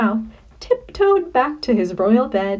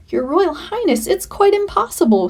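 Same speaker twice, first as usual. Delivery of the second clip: higher, whiny plea that climbs higher still; a frightened cook sniveling his excuse; lightly acted snivel, pitch jumping up; 9 semitones higher than usual.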